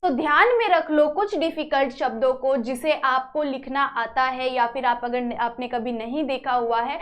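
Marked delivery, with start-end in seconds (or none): none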